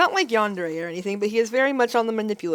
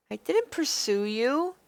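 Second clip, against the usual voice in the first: put-on pitched up, concerned young woman; raised girlish heroine voice, worried; earnest heroine voice; 4 semitones higher, slower than usual. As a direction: play the heroine, eager to help.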